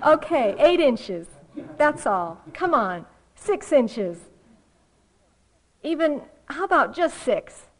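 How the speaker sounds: loud, punchy mock haggling; Calvin bargaining. Cartoon kid haggling with the heavens over inches, loud mock bargaining. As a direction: haggle hard, each offer its own burst. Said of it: "You know, bargaining".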